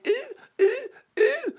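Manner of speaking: very high mock-curtsy "ooh" squeals; Meghan. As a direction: imitate silly, high-pitched oohs to ridicule her. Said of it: "literally mocking Queen Elizabeth".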